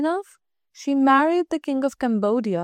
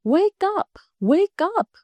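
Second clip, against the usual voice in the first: high bright call from a little bird; a small bird's loud morning wake-up cry; calling loudly; 6 semitones higher, slower than usual. A narrator's reading. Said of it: "calling her at the top of his voice".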